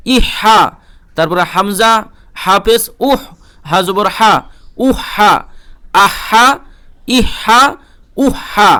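normal talking voice

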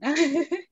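short giggle at being teased; amused laugh. Real, not acted. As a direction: burst into an embarrassed giggle. Amused by host's percentage tease.

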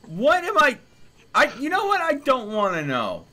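high, loud sputtering disbelief; sputter, too shocked to finish; flustered outburst. Real, not acted. Gamer cuts himself off after the fursuit news, loud sputter.

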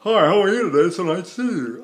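"Hi, how are you today" with misplaced, distorted vowels; "nice to see you" in an oddly placed singer's tone. Shown as a demonstration. Voice teacher mocking distorted language, "how are you today" with misplaced vowels. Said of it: "spoken like that in the world".

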